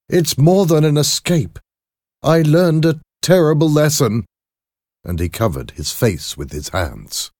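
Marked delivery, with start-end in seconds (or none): 0.1-4.3 high, loud, emotional confession; distraught doctor. Acted. Doctor confesses a terrible lesson to his lawyer, distraught. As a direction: pour it out in a raised, higher voice. Voices Jekyll distraught after escape.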